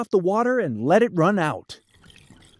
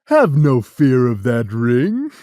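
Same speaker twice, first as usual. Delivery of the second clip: deep, loud, slow cat voice; Cat in the Hat booming low; laughing cat; 7 semitones lower, 7 dB louder than usual. Acted. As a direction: boom it out like a jolly big cat. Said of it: "laughed the cat in the hat".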